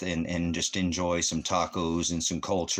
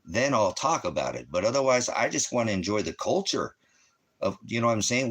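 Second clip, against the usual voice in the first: usual speaking voice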